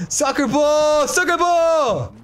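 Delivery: high-pitched gleeful shout; soaring, excited cry; excited yelling. Real, not acted. Ball appears in the game.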